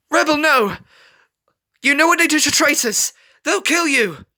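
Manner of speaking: high, loud frantic begging; desperate plea. A narrator's reading. Scarlet pleading with her brother Rebel, high frantic plea. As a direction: beg him not to stay.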